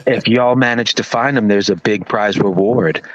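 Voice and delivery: low, slow sultry radio DJ. Retold or imitated. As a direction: purr it like a late night radio host. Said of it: "the most, like, sultry radio voice ever".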